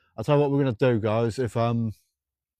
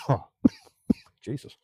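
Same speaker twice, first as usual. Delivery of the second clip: ordinary talk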